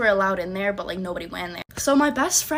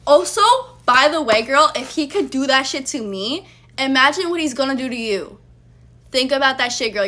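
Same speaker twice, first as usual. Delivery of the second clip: louder, higher pointed warning; heated call-out; 3 semitones higher, 7 dB louder than usual. Real, not acted. Speaking straight to the ex best friend, heated warning. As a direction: lean in and warn her hard.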